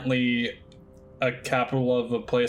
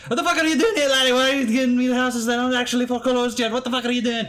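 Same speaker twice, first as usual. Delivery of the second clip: high, loud, rushed Scottish-Irish tirade; mock Scottish rant; 11 semitones higher, 4 dB louder, faster than usual. Supervisor berating the agent over the phone, Scottish accent. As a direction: rage at the laddie in a hammed-up Scottish accent. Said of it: "make a distinction in an impression".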